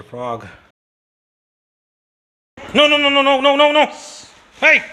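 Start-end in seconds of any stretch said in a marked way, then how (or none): 2.7-4.8 high, very loud string of no's; real alarmed high-pitched yell; urgent shout. Real, not acted. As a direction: shout sharply to stop it right now. Urgently stopping an excited animal.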